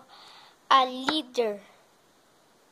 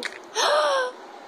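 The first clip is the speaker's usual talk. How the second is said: gasp; 10 semitones higher than usual. Acted. Frightened reaction.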